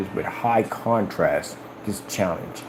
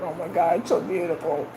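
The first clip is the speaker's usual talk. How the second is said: mock teary gush; 7 semitones higher, 4 dB quieter than usual. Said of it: "they're crying like".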